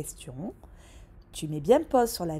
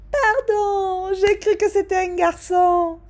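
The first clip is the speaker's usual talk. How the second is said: high, loud flustered apology; sorry, slightly teary waitress; a much higher, loud apology; apologetic waitress; 12 semitones higher, 11 dB louder, slower than usual. Acted.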